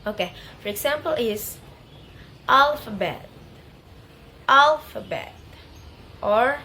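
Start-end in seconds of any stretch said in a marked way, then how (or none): 2.5-5.3 "alphabet" with AL punched loud; heavy AL in "alphabet", lighter stress on BET; stress on first syllable. Shown as a demonstration. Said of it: "primary stress in the first syllable".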